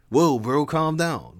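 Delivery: calm him down, dude style; chiding bro. Acted. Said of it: "Levi chided".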